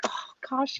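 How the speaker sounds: breathy whispered relief; soft whispered gasp of relief; relieved whisper. Retold or imitated. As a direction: whisper it like a weight just lifted. Relief at finally solving a problem.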